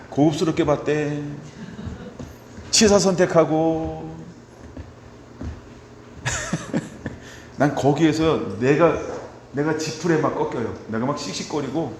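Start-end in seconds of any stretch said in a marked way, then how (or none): 6.2-7.1 a quick self-mocking laugh; short laugh. Real, not acted. Pastor laughing at his own impatience with the congregation. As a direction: laugh at your own story.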